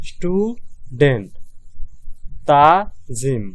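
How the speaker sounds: slow, syllable-by-syllable student, stu-dent; split into syllables. Shown as a demonstration. Teacher showing students the two syllables, slow split. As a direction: break student into its two parts slowly. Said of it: "দুইটা উচ্চারিত হইতেছে".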